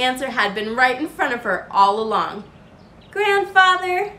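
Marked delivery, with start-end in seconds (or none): 3.1-4.1 beaming gently. A narrator's reading. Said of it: "she beamed gently".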